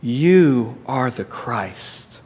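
very slow, hushed declaration; Peter answering. Retold or imitated. Peter answers Jesus, slow and hushed in awe. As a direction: say it slowly and softly, with awe. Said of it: "And Peter answered him".

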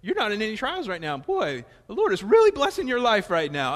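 loud laughing parody of church talk; mock churchy blessing, laughing. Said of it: "We say".

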